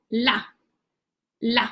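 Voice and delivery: la said alone and slowly, as the final syllable of hola; syllable drill. Shown as a demonstration. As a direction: isolate the syllable "la" with a pause before it. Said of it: "How do we pronounce hola?".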